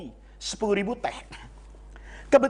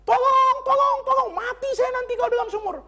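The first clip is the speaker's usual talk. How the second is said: desperate cry for help; 12 semitones higher than usual. Acted. Man fallen in a well calling for rescue, panicked shout. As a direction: yell up from the bottom of the well.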